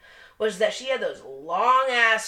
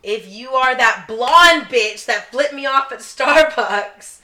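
mock challenge; 3 semitones higher, 7 dB louder, slower than usual. Challenging the driver on air.

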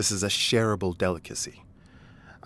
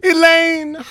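high sung-out call of her name; loud greeting; 10 dB louder than usual. Acted. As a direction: belt out her name cheerfully.

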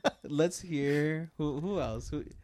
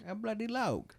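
Michael Caine impression; 6 semitones higher, 7 dB quieter than usual. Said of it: "it's that".

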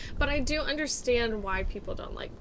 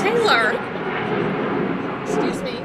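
shouting; 2 semitones higher, 10 dB louder than usual. Real, not acted. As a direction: yell up at the pilot. Yelling at a low plane.

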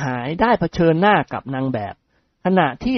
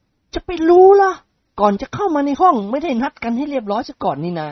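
comic grumbling voice; 11 semitones higher than usual. Acted. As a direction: squeak it out like a comic sidekick. Grumbling friend answers. (เสียงตัวตลกบ่นกระแทก)

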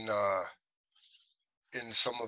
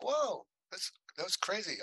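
high, soft amazed aside; 8 semitones higher, 6 dB quieter than usual. Retold or imitated. Actor recalling fans recognizing him, amazed. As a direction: quietly marvel in a raised pitch.

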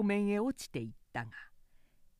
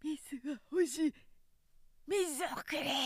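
mild dying cry; 7 semitones higher than usual. A narrator's reading. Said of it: "絶叫しながら息を引き取ってしまう".

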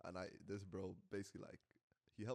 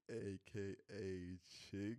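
drawn-out intro nickname; 2 semitones lower, 4 dB quieter, much slower than usual. Acted. Hosts' intro catchphrase, drawn out.

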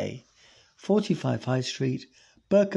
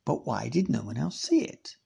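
usual speaking voice

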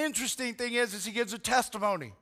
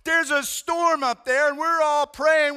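higher, louder panicked shout over a storm; frantic captain; 6 semitones higher, 7 dB louder, slower than usual. Retold or imitated. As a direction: yell through the storm to wake him. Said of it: "He's like".

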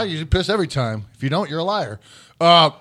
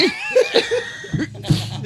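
high laugh. Real, not acted. Laughing at own impression.

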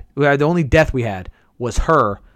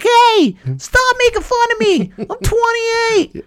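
much higher, squeaky protest; slower than usual. Real, not acted. Gamer mocked for his voice, squeaky high protest. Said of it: "I sound like a twelve-year-old girl".

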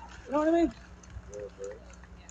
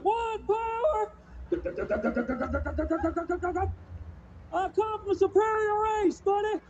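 higher, slow hammed-up bluster; 4 semitones higher, slower than usual. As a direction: puff up like a proud bigot.

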